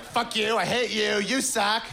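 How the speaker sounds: high, hammed-up angry ranting; mock angry rant. Blogger ranting high and mock angry. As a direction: sneer it out like a furious blogger. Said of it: "feminist blogger".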